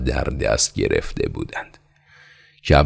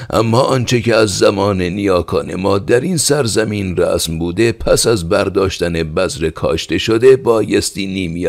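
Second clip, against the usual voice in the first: raised, high voice pressing his case; old peasant father arguing; 7 semitones higher, 5 dB louder than usual. Acted. Father confronting the khan about the ancestral share. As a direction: argue firmly for the old custom.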